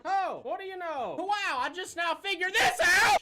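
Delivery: high, loud, slow mock amazement; sarcastic surprise. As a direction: act fake stunned amazement. Fake discovery of the photo.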